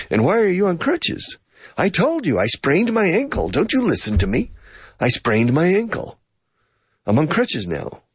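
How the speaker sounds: hammed-up irritation; mock indignant. Acted dialogue, mock exasperation.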